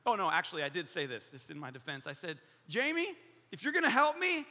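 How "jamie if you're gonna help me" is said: high, exasperated plea; heated, hammed-up ultimatum; mock angry. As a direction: act out a fed-up worker, pitched up. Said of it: "I'm so angry".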